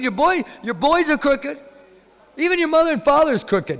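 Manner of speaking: high, loud mock scolding; scolding gopis. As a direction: shriek the insults like offended girls. Gopis scolding Krishna loudly.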